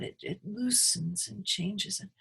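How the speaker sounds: ordinary delivery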